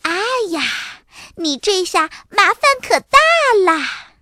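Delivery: high, teasing little-sister voice; stirring up trouble. A narrator's reading. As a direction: rub it in with gleeful alarm. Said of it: "多拉在一旁火上浇油地说".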